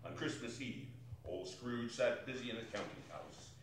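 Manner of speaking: low, soft fireside narration; hushed storytelling. A narrator's reading. Narrator setting the cold Christmas Eve scene, hushed. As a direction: draw listeners in quietly.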